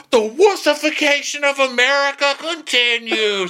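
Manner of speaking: very high mock wail; crying little-boy screaming. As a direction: proclaim it in a bawling child's whine. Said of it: "literally crying like a little boy".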